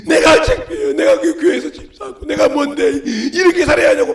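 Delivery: loud, mock-wailing complaint; hammed-up aggrieved sobbing plea; imitated tearful prayer. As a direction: raise the voice like a believer crying to God. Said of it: "그런데 막 울어".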